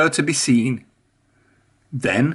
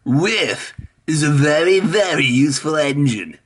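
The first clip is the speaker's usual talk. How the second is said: impressed Spencer; 2 semitones higher than usual. A narrator's reading. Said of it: "he wished".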